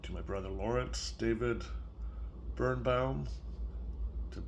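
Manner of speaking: ordinary delivery, nothing marked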